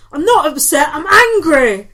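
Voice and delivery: exaggerated shouted protest, played for laughs; mock angry shout. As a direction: yell it in hammed-up indignation. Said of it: "they heard her shouting".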